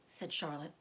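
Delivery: plain reading voice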